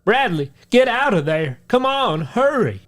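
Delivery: high, urgent call; voicing friend calling. Jacob calling to Bradley from the trail, high urgent call. Jacob calls back in panic.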